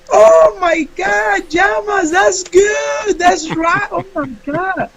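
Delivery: over-the-top camp teacher. Retold or imitated. Teacher praising student's answer, high squealing camp voice. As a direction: shriek the praise like a flamboyant teacher.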